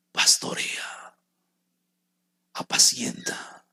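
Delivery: slow whispered urging; a preacher whispering the command to his flock. Real, not acted. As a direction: breathe the words out slowly. Whispered exhortation in sermon.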